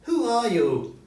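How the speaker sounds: put-on high, loud frightened old miser; Scrooge's startled question. Scrooge startled by his partner's ghost, high and loud.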